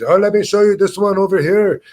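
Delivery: put-on breezy Dutch-accented tour guide pointing the way; half Dutch accent. Friend guiding them round Amsterdam, in a light half Dutch accent. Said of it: "This half Dutch, weird kind of accent".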